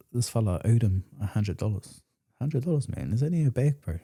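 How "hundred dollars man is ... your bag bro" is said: higher-pitched incredulous demand; acted debt challenge. Acting out a debt confrontation.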